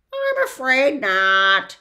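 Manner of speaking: slow, loud, high mournful bird; sad. Acted. The bird answers Milo's question, slow and sad. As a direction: admit it with a sorry sigh. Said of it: "he replied sadly".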